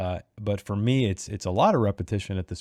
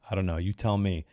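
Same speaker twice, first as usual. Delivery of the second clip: ordinary talk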